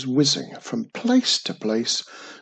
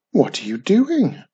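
higher-pitched, nosy question; curious interruption; 6 semitones higher, 4 dB louder than usual. A narrator's reading. Witch interrupting her busy cousin, curious and high. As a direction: break in with lively curiosity. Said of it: "Wizardy Witch interrupted".

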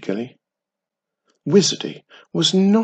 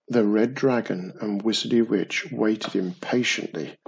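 plain reading voice

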